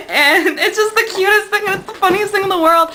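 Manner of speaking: high, laughing fangirl delight; giggly gushing. Real, not acted. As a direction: gush through real giggles. Giggling over cutest scene.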